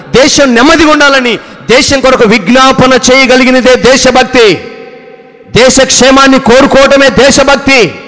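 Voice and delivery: loud, high sermon delivery, hammering each phrase; fiery preaching. Acted. Pastor denouncing false patriotism to the congregation in loud fiery preaching. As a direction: preach it with full conviction to the congregation.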